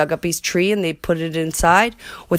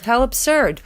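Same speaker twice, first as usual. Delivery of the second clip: mild mocking yell; 6 semitones higher than usual. A narrator's reading. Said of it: "they yelled".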